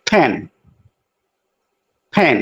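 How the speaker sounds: ফ্যান with a puffed ph; aspirated p. Shown as a demonstration. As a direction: blow air on ফ্যান. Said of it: "original উচ্চারণ হবে ফ্যান".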